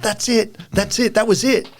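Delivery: high-pitched, eager retold outburst; sudden excited recognition. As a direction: imitate someone lighting up as the name clicks. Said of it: "eyes lit up like dish plates and he went".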